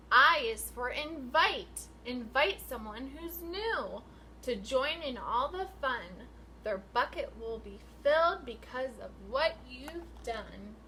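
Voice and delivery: sing-song picture book read, rising and falling; reading to children. Acted. Teacher reading a rhyming book to her class, sing-song. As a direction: read the rhyme out to the class with lilt.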